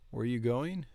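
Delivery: normal talking voice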